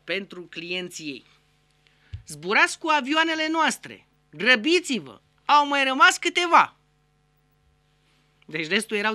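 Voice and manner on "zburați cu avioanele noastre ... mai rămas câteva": high, loud airline slogan; ad pitch. Acted. Airline advertising to its customers, loud and excited. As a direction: sell it like a hyped ad.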